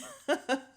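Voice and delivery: amused burst of laughter; real laugh. Real, not acted. Podcaster laughing at Keisha's remark, amused laugh.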